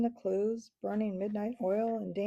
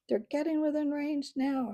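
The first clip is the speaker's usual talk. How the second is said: raised higher call; shouted; 7 semitones higher, 3 dB louder than usual. Acted. Matthias warns the archers the birds are in range, raised shout. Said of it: "shouted Matthias".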